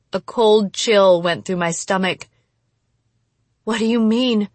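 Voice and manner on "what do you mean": usual speaking voice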